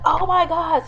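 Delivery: high-pitched dramatized gasp of words; retold shock. Viewer hears the death threat, high-pitched retold shock. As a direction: blurt it high, re-enacting your shock.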